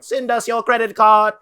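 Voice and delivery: high, loud fake-voice demand; hammed-up pleading scam call; mock scammer. Scammer posing as sons to parents, mock demand. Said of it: "and be like".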